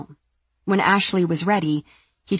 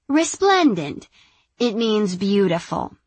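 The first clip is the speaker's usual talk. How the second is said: "Resplendent" said smoothly, high and sure; woman's voice, correct pronunciation; 4 semitones higher than usual. Acted. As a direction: say "Resplendent" fluently in a higher woman's voice. Sandy answers Lars.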